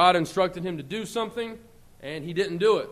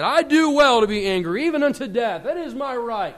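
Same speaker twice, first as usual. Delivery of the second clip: defiant Jonah; 6 semitones higher, 7 dB louder than usual. Retold or imitated. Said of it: "and he said".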